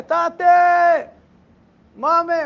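put-on high, loud wail of a hungry baby; imitating a crying baby. Father imitates his hungry baby crying at night, high and loud. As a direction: cry out like an infant wanting food. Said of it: "הוא בוכה".

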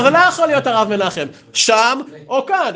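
high, loud objection; acted exasperated protest. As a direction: protest loudly, demanding one choice. Retold exasperated argument.

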